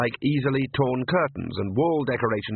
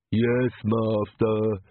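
slow, dragging servile reply; Igor servant voice; much slower than usual. A narrator's reading. Coachman answers his master, slow and servile. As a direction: grovel like a hunchback servant. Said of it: "The coachman turned".

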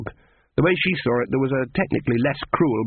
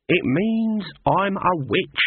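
slow pronouncement; 6 semitones higher, much slower than usual. A narrator's reading. Granny declares herself.

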